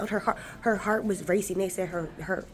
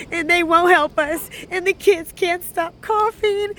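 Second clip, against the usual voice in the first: high, loud, upset pleading; distraught complaint; 8 semitones higher, 5 dB louder than usual. Real, not acted. Telling how the ER won't help the kids, distraught. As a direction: plead loudly, pitch climbing with real distress.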